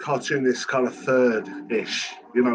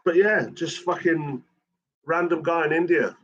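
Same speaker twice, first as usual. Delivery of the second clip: usual speaking voice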